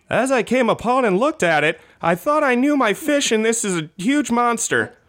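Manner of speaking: a hint of a startled angler; fisherman voice. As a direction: play the fisherman telling of his huge catch. Quoted fisherman in news read.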